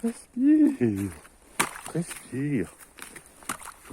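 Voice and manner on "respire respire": high, loud urging shout; shouted command. Acted. Gamer shouting at his friend to breathe, loud. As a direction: bellow it to push the animal.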